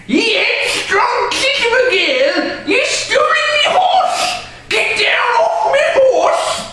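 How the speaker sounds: put-on high, loud ranting old drunk; angry drunk. Drunk owner shouting at girl to get off, raging. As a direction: rage like a reeling brute.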